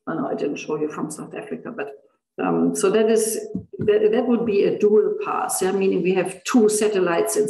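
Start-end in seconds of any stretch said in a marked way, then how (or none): 0.1-1.9 quiet aside. Real, not acted. Muttered aside correcting himself.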